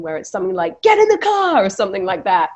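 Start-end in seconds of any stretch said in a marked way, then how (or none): 0.8-1.6 sudden high, loud shouted command; action-scene shout. Shown as a demonstration. Actor showing a high octane movie line, shouted. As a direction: bark it like an action hero. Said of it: "in scenes where it's like a high octane situation".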